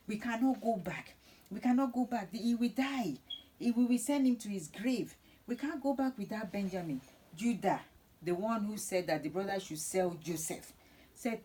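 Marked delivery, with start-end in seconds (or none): 0.1-7.0 higher-pitched, insistent retold plea; urgent pleading. Brothers begging Joseph to spare their old father, urgent pleading. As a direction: plead harder as you go, voice raised in pitch.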